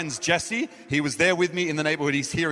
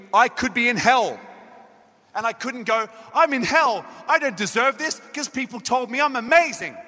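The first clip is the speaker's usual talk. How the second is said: raised, emphatic preaching, pitched higher; 6 semitones higher than usual. Acted. Preacher pressing the congregation on salvation, raised preaching voice. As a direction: preach it out over the music. Preaching hard about spiritual pride.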